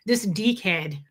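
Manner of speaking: put-on this deekhead in a jokey Australian accent, long ee; mock Australian accent. Telling chat about her cat in mock Australian. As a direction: say deekhead like an Aussie would, dick as deek. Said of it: "That's how you say it in Australian".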